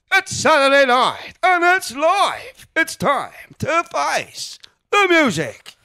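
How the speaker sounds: huge high, slow TV show announcer; Peter Dixon. Shown as a demonstration. Imitating the X Factor announcer, loud drawn-out show intro. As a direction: boom it out, stretching every phrase. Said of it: "I like the one, the X Factor guy".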